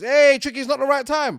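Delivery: loud, high sarcastic mimicry; mock their line with scorn; mocking quote. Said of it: "Of course it is".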